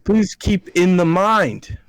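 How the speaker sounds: loud, high, drawn-out emphatic read; all-caps exclamation. Acted. Reading an all-caps line from an email, emphatic exclamation. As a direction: slowly proclaim it like a line in capitals. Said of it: "this is in all caps".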